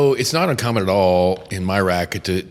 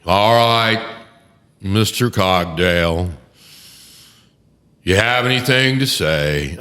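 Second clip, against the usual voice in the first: slow, louder stately judge; judge's voice; 4 dB louder, slower than usual. Retold or imitated. Lawyer imitating the stately judge calling on him in court, slow and louder. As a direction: imitate the patrician old judge addressing counsel. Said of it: "he talks like this".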